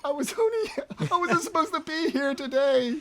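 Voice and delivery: high, laughing, pleading henchman; whiny enemy voice. Retold or imitated. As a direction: squeak it out like a doomed goon. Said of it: "He's like".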